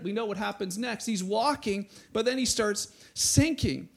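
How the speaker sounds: ordinary talk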